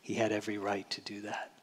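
hushed whisper to the congregation. Real, not acted. Preacher whispers to congregation after the mock speech. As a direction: breathe it almost unvoiced, weighty.

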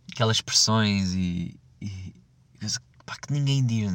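ordinary delivery

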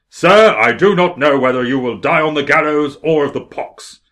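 loud, slow, pompous British lord; John Montague. Acted. Lord insulting John Wilkes, loud angry declaiming. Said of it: "John Montague is a lord".

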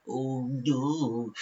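soft little dog voice; Queen Maud. Acted. Owner voices the pet dog, in a soft put-on voice. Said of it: "my voice from my dog Queen Maud".

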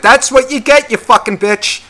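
high, loud mock-triumphant taunt; hammed-up spiteful shout; vindictive gloating. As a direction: crow it at an enemy. Acted gloating at injured woman.

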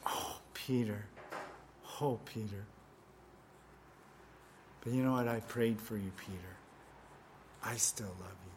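soft, low, gentle sorrow; tender compassion. Pretend. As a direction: comfort a friend who let you down. Imagined tender words of Jesus.

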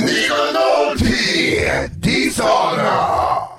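loud, high hammed-up wail; drawn-out theatrical yelling; mock war cry. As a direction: shout it like an over-the-top battle cry. Mock battle cries into voice machine.

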